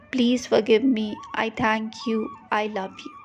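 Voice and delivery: ordinary talk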